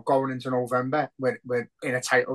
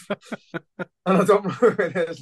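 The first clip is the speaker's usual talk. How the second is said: laughing; 6 semitones higher, 3 dB louder, slower than usual. Real, not acted. Laughing at the picture.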